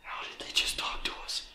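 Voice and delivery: barely voiced, very soft whisper; awed whisper. Real, not acted. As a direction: whisper it to your friends in disbelief. Awed after hearing a voice.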